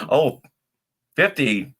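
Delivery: ordinary talk, nothing marked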